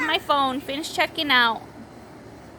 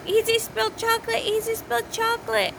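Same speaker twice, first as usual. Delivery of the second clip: retold child's scream; 8 semitones higher than usual. Three-year-old reporting spilled chocolate to mom, imitated high yell. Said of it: "starts screaming".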